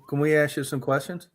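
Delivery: ordinary talk, nothing marked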